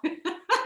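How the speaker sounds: short laugh. Real, not acted. Laughs at chickens in aisle.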